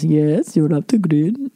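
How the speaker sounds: lightly put-on Nigerian accent; hint of a Nigerian accent. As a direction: answer calmly in a Nigerian accent. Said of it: "He goes".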